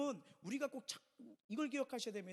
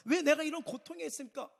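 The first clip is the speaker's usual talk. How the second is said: a loud, higher mock cry of why me; Paul's lament; 6 semitones higher, 8 dB louder than usual. Pastor voices jailed Paul protesting to God, loud. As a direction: cry out as the suffering apostle. Voicing Paul's imagined complaint.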